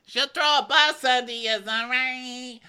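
high, loud mock voice; mocking Ramona. Cohost joining in, mocking Ramona in a high, loud voice. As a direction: ham up the catty housewife. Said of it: "joins the Ramona impression".

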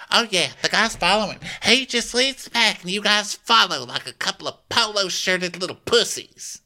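high, fast mock-catty Madison voice. Podcaster mocking Madison taunting the guys, high catty voice. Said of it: "So Madison's like".

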